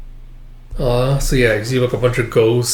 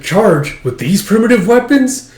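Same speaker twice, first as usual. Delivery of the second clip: higher, disbelieving echo; incredulous question; 9 semitones higher, 4 dB louder than usual. Acted. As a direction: scoff at the old spears. Kafka baffled by old weapons.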